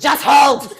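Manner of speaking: loud, high burst mid-contraction; sudden strained bellow; pained shout. Acted. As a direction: yell it through acted labour pain. Acts caller in peak contraction.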